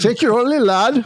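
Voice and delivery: high, loud jokey Scottish brogue; playful fake Scottish accent; mock Scottish accent. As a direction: ham up a Scottish accent for a laugh.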